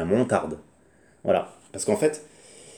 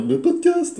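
squeaky high funny voice; silly voice; 9 dB louder, slower than usual. Shown as a demonstration. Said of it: "utiliser une voix".

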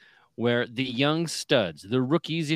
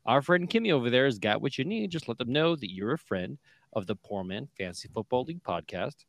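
usual speaking voice

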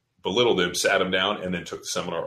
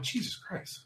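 whispered, disbelieving oath; hushed exasperation; exasperated aside; 11 semitones higher, 12 dB quieter, slower than usual. Retold or imitated. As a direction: curse under your breath. Retold exasperated reaction.

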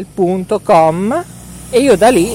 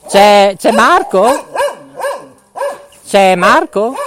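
raised voice, calling across the street; 6 semitones higher, 6 dB louder, slower than usual. Real, not acted. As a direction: call out loud to a friend nearby. (chiama a voce alta un amico per strada)

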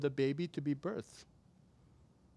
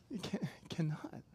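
hushed whispered aside; 7 dB quieter than usual. Acted. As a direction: drop to a breathy whisper. Aside after rhetorical questions.

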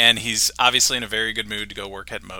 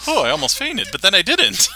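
higher mock Homer delivery; Homer's dramatic quip; 9 semitones higher, 5 dB louder than usual. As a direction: play it like Homer, surprised then deadpan. Homer quoted reacting to news.

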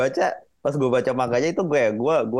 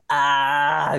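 long, open "aah" of suspense, higher pitched; seruan terpukau; 4 semitones higher than usual. Retold or imitated. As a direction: imitate a crowd's drawn-out gasping "aah". Menirukan momen tegang penonton. (seruan "aah" panjang menirukan penonton)

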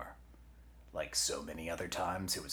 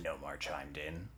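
ordinary delivery

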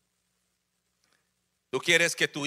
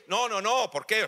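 usual speaking voice

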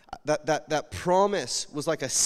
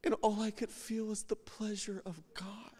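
emotional whisper; 5 semitones higher, 8 dB quieter than usual. Real, not acted. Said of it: "And I get emotional".

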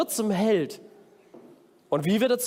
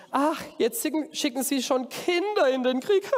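higher-pitched scoffing giant, ending in a laugh; mocking; 6 semitones higher than usual. Goliath scorning young David, mocking with a laugh. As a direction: sneer at the opponent, then laugh. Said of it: "Spottete er".

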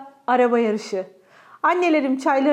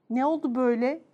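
usual speaking voice

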